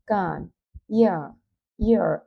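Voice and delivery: ordinary talk, nothing marked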